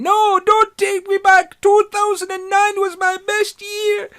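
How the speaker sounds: high, loud, slow mock-desperate shout; hammed-up pleading yell. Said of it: "[name] shouting".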